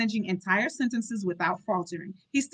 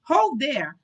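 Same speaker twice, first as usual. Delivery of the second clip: a touch louder, quick and firm; quick firm call; 5 semitones higher, 5 dB louder than usual. Acted. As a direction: stop him quickly. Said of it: "Nurse said quickly".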